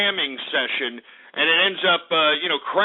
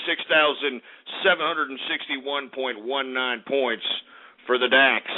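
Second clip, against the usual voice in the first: normal talking voice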